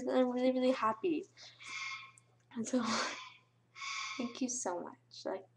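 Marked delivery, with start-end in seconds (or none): 2.5-5.4 overwhelmed whisper. Real, not acted. Overwhelmed thanking subscribers.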